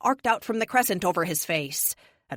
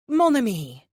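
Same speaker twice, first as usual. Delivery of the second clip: put-on light French-accented plea, louder; 5 semitones higher, 7 dB louder than usual. A narrator's reading. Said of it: "delightful French accent".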